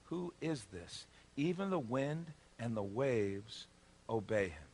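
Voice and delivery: slow, hushed wonder; quiet, drawn-out astonishment; awed fear. Acted. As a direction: ask softly, stunned and a little afraid. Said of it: "They were terrified".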